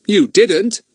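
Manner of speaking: lightly voiced doubting schoolboy; Larry voice. Larry scoffs at Ern's story, high and doubtful.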